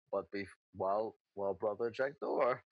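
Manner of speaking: lightly voiced simple character, low and soft. Boom Boom asking brother to check the door, low simple voice. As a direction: play Boom Boom low and subdued. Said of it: "I pull out the kobold head and I go".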